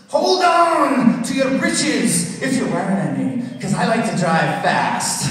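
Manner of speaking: loud, slow mock Scottish; mock Scottish accent. Scottish GPS voice warning the driver. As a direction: drawl it like a reckless Scot. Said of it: "it's actually Scottish".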